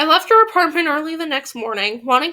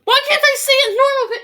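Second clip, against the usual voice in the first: loud, high cry of frustration; upset wail; 13 semitones higher, 5 dB louder than usual. Real, not acted. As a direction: wail at yourself, voice way up. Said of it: "I'm so upset".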